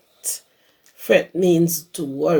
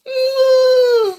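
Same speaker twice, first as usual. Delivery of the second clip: much higher, louder wailing call; crying shout; 5 dB louder than usual. Acted. Baby llama shouts for mama, high crying wail. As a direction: shout for mama through tears. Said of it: "jumps and shouts".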